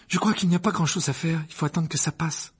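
worried. Acted. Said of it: "Hermione d'un ton inquiet".

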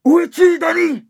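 high, loud call; father calling to his son in the dark. Acted. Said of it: "disait mon père".

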